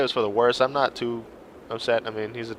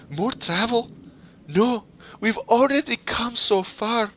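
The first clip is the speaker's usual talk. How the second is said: put-on high, slow whiny girl voice; a pouting girl whining at the old Varl about more travel; 9 semitones higher, slower than usual. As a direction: sulk it out like a tired girl. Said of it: "Stop that pouting, girly".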